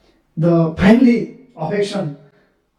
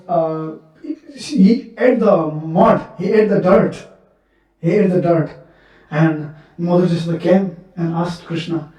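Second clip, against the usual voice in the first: ordinary talk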